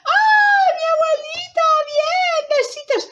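put-on very high little-girl cheering; overjoyed squeal. Girl reunited with her grandmother, high joyful squeals.

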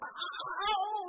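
sudden high ow, thrown out loud; mock yelp of pain. Child pinched by mother in town, sharp yelp.

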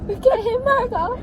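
loud, high, slow cry; mock wail. Real, not acted. Playfully wailing to her friend. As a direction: wail it dramatically. Wailing over sad story.

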